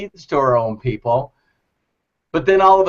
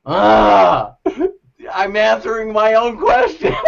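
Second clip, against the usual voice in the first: laughing realization; 6 semitones higher, 4 dB louder than usual. Retold or imitated. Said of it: "he's hearing himself going".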